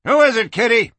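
high, loud call along a hallway; hollering. Acted. Someone calls to the madam down the hall, hollering. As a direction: holler the question from afar. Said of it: "a voice hollered from along the hallway".

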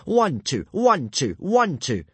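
high, loud drill call; punched, falling count on each step; marching drill shout. Acted. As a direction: bark the count like a drill instructor. Drill leader calling out marching orders.

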